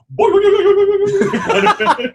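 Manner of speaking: loud, high buzzing saw sound; imitated saw noise. Co-host shows the saw in the title card, loud imitated buzz. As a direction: make a revving saw noise.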